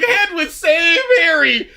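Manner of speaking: high shouted plea to the owl; mock shout. Fan yelling at the screen for Hedwig. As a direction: yell for Hedwig to save him. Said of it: "I was just like".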